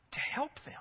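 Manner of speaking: ordinary talk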